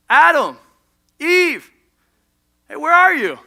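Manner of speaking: high, loud searching call. Acted. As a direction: call names across a garden. God calling out in the garden.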